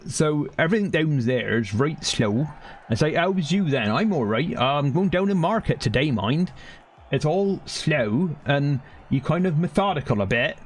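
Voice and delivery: slow, drawn-out West Country accent. Shown as a demonstration. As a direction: put on a methodical West Country dialect, stretching the vowels. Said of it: "that's the West Country, really ... very drawn out".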